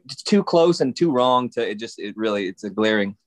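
ordinary talk, nothing marked

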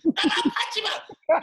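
high shouty voice, laughing; squeaky laughing shout; high-voiced Chris. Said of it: "Chris with his high voice".

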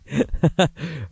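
short high giggling laugh. Real, not acted. Podcaster laughing off his own joke, real laugh. Laughs after saying he is kidding.

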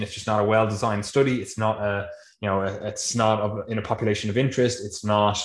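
ordinary talk

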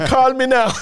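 high, loud Caribbean TV pitch; shrieky mock island accent. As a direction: laugh through a hammed-up Caribbean accent.

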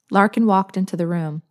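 usual speaking voice

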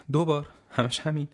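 pitched well up, disbelieving; incredulous. Acted. Koppel hearing he was seen twice, incredulous. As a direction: act stung that he only watched twice.

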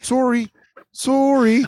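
very high Canadian apology; mock Canadian accent. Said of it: "did the Canadian voices".

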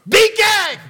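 high, very loud bark; explosive shouted order; loud command. Acted. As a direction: roar it at the storm. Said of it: "commanded in a loud tone".

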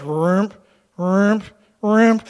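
loud, separate growled bursts; imitated rump noise. God cutting the numbers down, imitated sound effect. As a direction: punch out each noise with a pause. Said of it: "God always takes it and goes".

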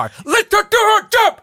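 high-pitched, drawn-out acted yell; shouting. Manager ordering the bodyguard to back off, acted shout. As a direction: holler it as an exasperated order. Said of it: "ropar han".

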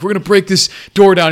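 hammed-up heroic declaration; mock tough shout. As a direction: bark it like macho soldiers ready to charge. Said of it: "and they're like".

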